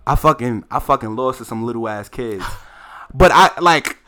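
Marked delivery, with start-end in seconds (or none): 3.1-4.0 louder and higher, insisting he won; worked-up protest; indignant outburst. Real, not acted. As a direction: protest that everybody knows you won.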